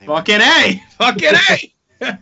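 loud, high excited cheer; excited shout. Real, not acted. Excited reaction to idea.

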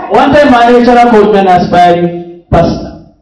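loud, high, excited preacher; full-force preaching. Real, not acted. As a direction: belt out the anecdote to a laughing crowd. Preaches over audience laughter.

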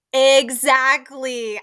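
loud, stretched-out exclamation; shouted agreement. Retold or imitated. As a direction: re-enact yelling agreement at the screen. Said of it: "I, like, shouted at the screen".

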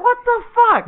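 very high, squeaky-pitched bafflement; acted incredulous outburst; mock disbelief. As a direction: shoot the pitch up in comic shock. Repeats his baffled reaction.

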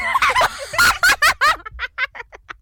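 high breathless giggling; helpless giggles. Real, not acted. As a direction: collapse into giggles. Giggling again.

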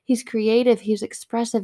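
ordinary talk

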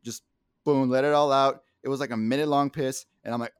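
ordinary talk

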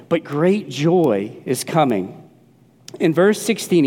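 ordinary talk, nothing marked

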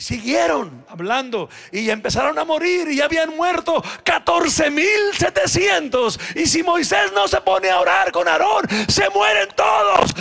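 loud, high preacher's shout; full-force preaching. Acted. Pastor retelling God's words to Moses, shouting. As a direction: thunder out God's warning.

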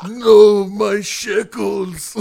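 loud, very slow hammed-up cry; mock wail. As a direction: howl it like a cartoon miser. Mocking friend's stereotype voice.

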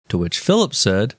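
normal talking voice

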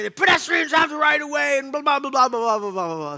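high, loud imitated yelling; mock yell. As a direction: bark it like the angry pedestrian. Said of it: "yelling at me".

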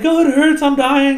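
high, loud hammed-up cry of pain; overdone dying-kid whine; mock agonized wail. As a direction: wail as if being crushed. Acts out kid crushed by suit.

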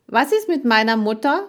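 put-on high, loud boy's question; inquisitive boy. A narrator's reading. Boy who eavesdropped asks about his mother, inquisitive.

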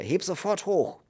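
high, furious command; shouted order. A narrator's reading. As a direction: order him angrily to lift. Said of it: "schrie er". (wütend geschrien)

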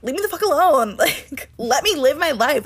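high-pitched exasperated plea, half laughing; retold pissed-off outburst. Telling the roommate to back off, retold pissed-off protest. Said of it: "I got really fucking pissed".

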